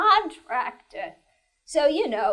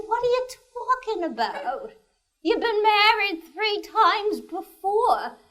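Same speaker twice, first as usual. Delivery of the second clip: raised, quick incredulous husband; 7 semitones higher than usual. Acted. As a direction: ask it in disbelief, quick and high. Husband retorts in joke.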